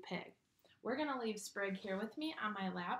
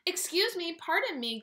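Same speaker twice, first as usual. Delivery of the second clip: higher, louder little pig squeezing past; lightly voiced pig pushing through the crowd; grunted politely; 9 semitones higher, 10 dB louder than usual. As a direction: squeak out polite excuses while wriggling through. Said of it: "grunted Pig".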